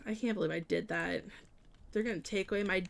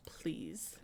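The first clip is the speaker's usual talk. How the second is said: whispered plea; 7 semitones lower, 13 dB quieter than usual. Real, not acted. Exasperated at chat's suggestion.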